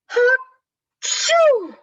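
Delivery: high, loud acted ha-choo; big mock sneeze. A narrator's reading. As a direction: explode into a storybook sneeze. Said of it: "He blows and he sneezes".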